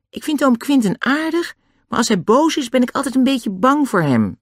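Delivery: put-on high little-girl character voice; timid Annie. Annie admits fearing her uncle, high timid voice. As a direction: pitch it well up, a bit anxious.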